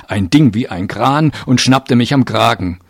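ordinary delivery, nothing marked

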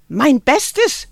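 high, loud outraged cry; screamed. A narrator's reading. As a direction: fling the words back at her. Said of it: "schrie Greta".

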